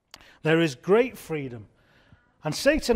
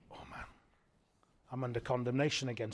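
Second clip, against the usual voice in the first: hushed, deflated self-reproach, acted lightly; mock dejection; 3 semitones lower, 11 dB quieter, faster than usual. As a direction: say it very softly, as an imitated private groan.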